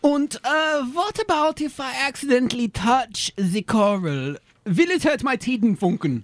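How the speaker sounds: put-on thick German tourist accent. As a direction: play a blunt German nudist. Tourist voice again.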